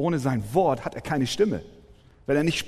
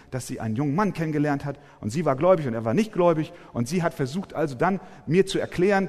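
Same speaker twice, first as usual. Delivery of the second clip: ordinary delivery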